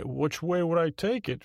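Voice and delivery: high-pitched, questioning sidekick; sidekick taking issue. Acted. Voices Bob challenging the host.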